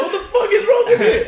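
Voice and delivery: very high, loud squeal, laughing all through; hammed-up angry yell through laughter; laughing mock outrage. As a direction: shriek it at him while cracking up. Retold angry outburst at drunk friend.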